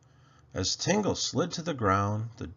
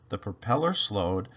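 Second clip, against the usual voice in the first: usual speaking voice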